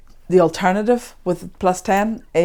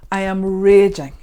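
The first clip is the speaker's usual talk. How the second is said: mild demonstrated anger; 7 dB louder than usual. Shown as a demonstration. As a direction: lean hard on RAGING in controlled anger. Voices rage in tapping script.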